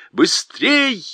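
high urgent shout. A narrator's reading. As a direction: yell to each other to hurry. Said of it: "Крикнув друг другу".

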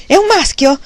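high, loud, elated cry; shouting again. A narrator's reading. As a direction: call it through the door, overjoyed. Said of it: "gridò un'altra volta".

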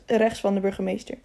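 ordinary delivery

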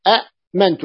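أأمنت with a glottal catch after short a; original two-hamza form. Shown as a demonstration. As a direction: cut the first a short, then mantu in أأمنت. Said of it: "والثانية ساكنة". (أأمنت بهمزة ثانية ساكنة)

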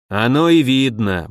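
ordinary delivery

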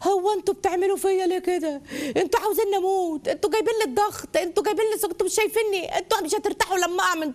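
high, wailing complaint; hammed-up self-pitying guilt trip; victim parent. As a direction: lament like a martyred mother. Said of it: "اسلوب الضحيه".

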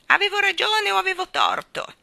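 loud, high-pitched bird squawk; crowing starling. A narrator's reading. The starling asking if it was right, loud shrill crowing. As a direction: crow it out high and loud, all on one pitch. Starling jeers again in narrated story.

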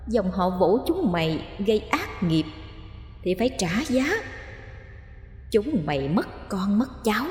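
soft, menacing ghost voice; vengeful ghost. A narrator's reading. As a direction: hush it like a vengeful spirit.

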